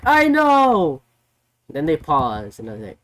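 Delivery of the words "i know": high, loud blurt; mock shouted admission. Swerve shouting his admission to Hangman, mock yell. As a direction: yell the confession, hammed-up. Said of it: "And then Swerve was like".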